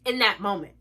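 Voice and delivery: ordinary delivery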